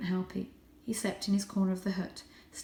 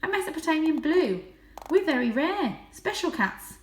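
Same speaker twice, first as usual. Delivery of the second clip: voiced high and light as a young cat; a proud little cat boasting; 9 semitones higher, 6 dB louder than usual. A narrator's reading. Young Varjak boasting his breed to the stray.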